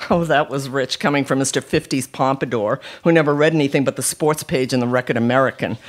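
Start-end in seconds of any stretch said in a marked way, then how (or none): none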